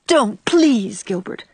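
please him to stop, softening at the end; pleading. A narrator's reading. Anne to Gilbert, begging him to stop, pleading. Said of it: "cried Anne pleadingly".

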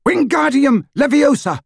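high, loud desperate shout; desperate spell shout. A narrator's reading. Harry saving the falling sidecar, loud desperate shout. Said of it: "Harry pointed his wand at the sidecar and shouted".

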